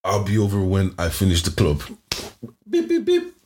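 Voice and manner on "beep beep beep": very high-pitched beep noises; imitated beeping. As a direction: beep like a little machine. Acts out beeps mid-story.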